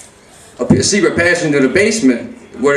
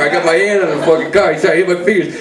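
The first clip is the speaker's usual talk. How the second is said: ordinary talk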